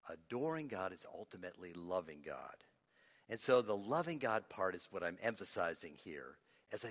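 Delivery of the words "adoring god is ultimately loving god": much softer and lower; hushed restatement. Real, not acted. Preacher repeating the key point to congregation, hushed. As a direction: let the point sink in quietly.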